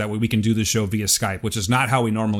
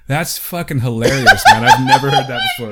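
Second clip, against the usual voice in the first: laughing; 7 dB louder than usual. Real, not acted. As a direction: crack up over the line. Still laughing at the email.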